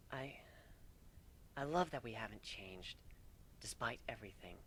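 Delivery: soft, nearly whispered; fond and intimate; tender. Acted. Kuno telling her husband she loves him, tender and soft.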